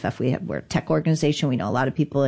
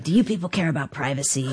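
raspy New York voice; faster than usual. Retold or imitated. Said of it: "he has this raspy voice ... very New York accent".

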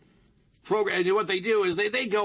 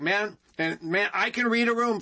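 ordinary talk

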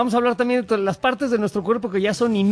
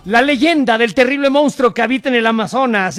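presentador dramático; 3 semitones higher, 5 dB louder than usual. Acted. Anuncia el tema como presentador.

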